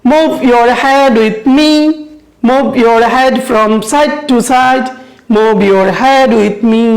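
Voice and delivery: slow model lines to repeat; measured teaching chant; rhyme recitation. Shown as a demonstration. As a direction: draw out each line for pupils. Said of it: "Repeat after me".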